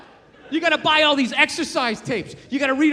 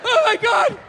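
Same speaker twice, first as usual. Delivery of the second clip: desperate dieter; 8 semitones higher than usual. Acted. Acted miserable dieter at breaking point.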